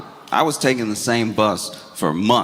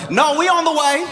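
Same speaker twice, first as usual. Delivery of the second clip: very high-pitched, defensive insistence; mock panic, pitch shooting way up; panicked denial. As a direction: double down in a squeaky panic. Acted panicked driver insisting he's right.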